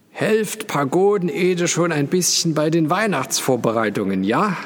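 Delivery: high, drawn call across a distance; calling through cupped hand. A narrator's reading. Father calling out to Paul outside, hand round his mouth, calling. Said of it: "legte die Hand um den Mund".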